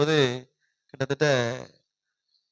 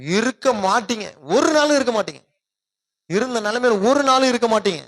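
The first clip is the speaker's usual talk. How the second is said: fiery preaching; 6 semitones higher than usual. Acted. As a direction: lift the congregation with a ringing call. Rising exhortation to congregation.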